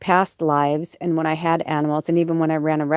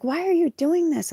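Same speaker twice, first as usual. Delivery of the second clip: very high mock-distressed whine; retold tearful pleading; 12 semitones higher than usual. Pleading with her cat after the accident, tearful. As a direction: plead in a high, half-crying voice. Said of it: "And I started crying".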